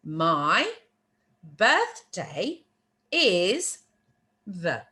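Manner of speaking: slow, separate words with pauses; deliberate teacher pacing, gaps between words. Shown as a demonstration. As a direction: spell out the phrase one word at a time. Teacher translating word by word.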